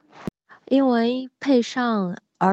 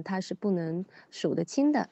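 ordinary talk, nothing marked

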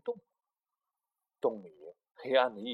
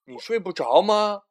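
much louder, higher question; loud call; 4 semitones higher, 14 dB louder than usual. Acted. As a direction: call across the cave. Big Bear asks little bear.

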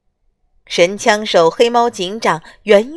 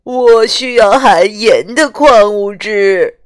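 slow, wronged hippo pleading; aggrieved; 7 dB louder, slower than usual. Acted. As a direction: sound hard done by, slowly. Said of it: "河马委屈地说".

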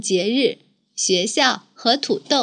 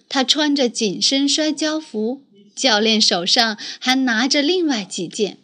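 ordinary delivery, nothing marked

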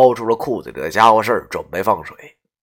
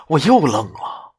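ordinary delivery, nothing marked

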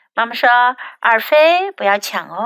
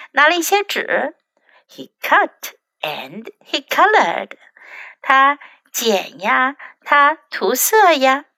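usual speaking voice

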